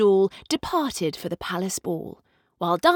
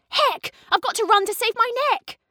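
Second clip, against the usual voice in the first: very high, fast alarmed yell; panicked shout; 13 semitones higher than usual. A narrator's reading. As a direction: rush off as midnight strikes. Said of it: "She shouted".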